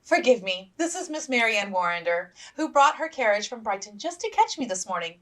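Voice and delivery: a hint of a fluttering hostess; flustered hostess. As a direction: introduce in a rush. Mrs Forster voiced.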